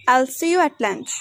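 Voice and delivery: contracted "I'll", short linked "at"; weak forms. Shown as a demonstration. Teacher showing learners weak forms, "at" short and reduced. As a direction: run "at" lightly into "lunch". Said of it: "We pronounced".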